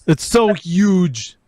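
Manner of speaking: high, slow, stretched so huge; hammed-up Trump bragging; Trump impression. Friend joins the Trump bit, slow hammed-up impression. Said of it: "Talk like Trump".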